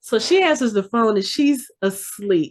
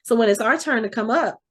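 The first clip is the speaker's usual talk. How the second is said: normal talking voice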